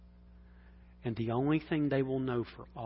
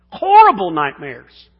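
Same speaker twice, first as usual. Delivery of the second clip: "Horrible nightmares!" burst out much louder; shouted repeat; 5 semitones higher, 18 dB louder than usual. Shown as a demonstration. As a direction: blast "Horrible" loud, like a preacher. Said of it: "I used to have horrible nightmares".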